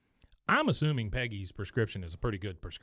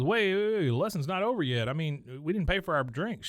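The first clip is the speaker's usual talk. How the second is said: raised, urgent imitation of protesting; mock calling after; 6 semitones higher than usual. Golfer stopping the drink girl leaving, mock protest. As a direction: call out higher, as if stopping someone.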